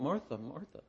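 ordinary delivery

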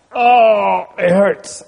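hammed-up "oh, it hurts" as a joke; mock pain. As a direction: pretend it hurts with a playful groan. Teacher jokingly plays being in pain.

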